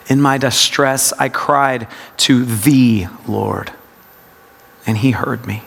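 low meditative reading, weight on THE; stress on THE. Shown as a demonstration. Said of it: "it's a practice of word emphasis".

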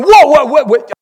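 high, loud, stammered double take; startled. Acted. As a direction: stop short in mock shock. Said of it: "You're going".

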